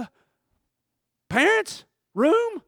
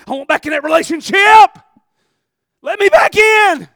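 desperate shout; 9 semitones higher, 17 dB louder than usual. Acted. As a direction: shout to be let back in. Begging God to reopen door.

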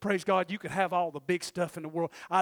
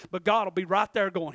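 ordinary talk

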